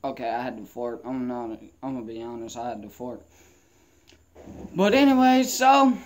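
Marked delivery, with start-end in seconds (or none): 4.7-6.0 sudden loud yelp; loud outburst. Real, not acted. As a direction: blurt it out loud. Sudden outburst after fart.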